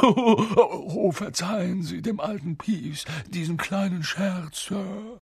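high-pitched grovelling poltergeist; servile, oily pleading; slimy. A narrator's reading. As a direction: apologise abjectly, with a nervous fawning laugh. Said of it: "sagte er schleimig".

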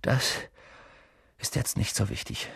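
breathless, quiet gasping words; out of breath. A narrator's reading. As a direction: speak short of breath. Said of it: "sagte Harry außer Atem".

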